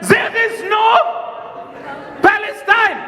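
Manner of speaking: officer yelling back in denial; shouted denial. Retold or imitated.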